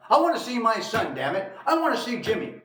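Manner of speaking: high, loud insistent demand. Acted. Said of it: "He demands to see his son".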